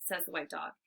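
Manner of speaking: ordinary delivery, nothing marked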